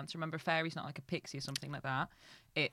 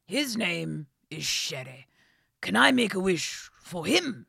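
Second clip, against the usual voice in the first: slow, loud, gruff king; old, wise and a bit posh; 10 dB louder, slower than usual. Acted. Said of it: "The king answered".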